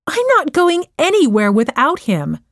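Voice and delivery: firm, upset refusal; mildly angry teen refusing to leave his dog. Acted. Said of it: "said Joe angrily".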